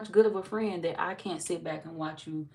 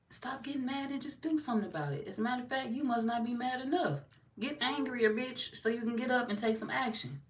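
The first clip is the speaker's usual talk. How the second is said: urgent push; 7 semitones higher than usual. Acted. Pushing listener higher pitched.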